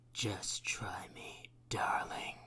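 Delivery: slow, soft unvoiced whisper. A narrator's reading. Cat Queen whispering menacingly into the mayor's ear. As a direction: whisper a threat into his ear. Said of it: "whispers into his ear".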